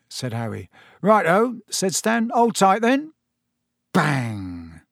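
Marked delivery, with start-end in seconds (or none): 1.0-1.6 high, bright cockney lad voice; a cheeky young conductor, sing-song; chirpy Stan. A narrator's reading. Stan to Harry on the bus, a chirpy high cockney. 2.3-3.1 high cockney call, a bit louder; chirpy Stan. A narrator's reading. Stan tells Harry to brace, high chirpy cockney. Stan warns before the bus lurches.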